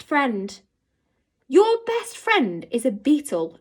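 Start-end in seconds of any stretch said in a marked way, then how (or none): 1.5-3.6 pitched-up incredulous scoff on "your"; mock a strange friendship. A narrator's reading. Girl scoffing at the boy's beetle friend. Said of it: "Novak scoffed".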